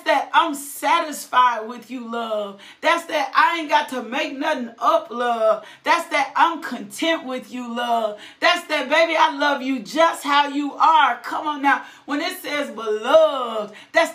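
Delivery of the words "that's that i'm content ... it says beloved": usual speaking voice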